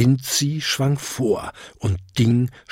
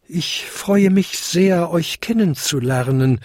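tinkling little bell; 8 semitones higher than usual. A narrator's reading. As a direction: ring out a cheerful hello. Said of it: "klingelte die kleinste Glocke". (hohe, klingelnde kleine Glocke)

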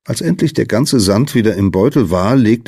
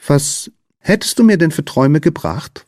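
lightly voiced higher child; curious little girl; 9 semitones higher than usual. A narrator's reading. Lina asking the Sandman about dreams, higher curious voice. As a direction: ask it pitched up, like a child.

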